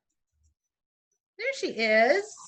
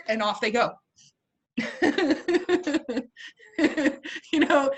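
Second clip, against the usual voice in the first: ordinary talk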